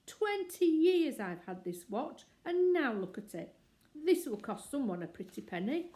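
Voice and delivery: loud, high outraged rant; angry. A narrator's reading. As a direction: rage at the ruined watch. Curry raging over his watch.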